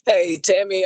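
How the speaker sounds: loud call to get attention. Real, not acted. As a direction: call her name loudly over the line. Calling out to check audio.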